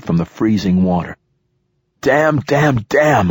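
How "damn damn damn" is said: higher, urgent repeated curse; pitched-up chant of swearing; cursing mantra. A narrator's reading. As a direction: repeat the oath like a frantic mantra. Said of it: "I said as a mantra".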